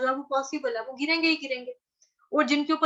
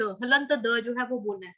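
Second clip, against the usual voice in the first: ordinary talk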